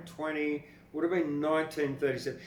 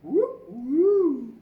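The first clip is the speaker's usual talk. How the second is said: higher, loud hooting woo; wolf whistle; 14 semitones higher, 11 dB louder than usual. Retold or imitated. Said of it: "the wolf whistle".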